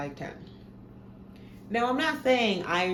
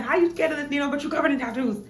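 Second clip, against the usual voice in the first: incredulous doctor; 8 semitones higher, 4 dB louder, much faster than usual. Retold or imitated. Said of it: "He was like".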